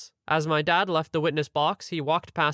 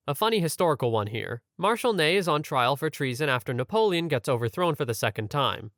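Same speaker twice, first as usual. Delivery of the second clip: ordinary delivery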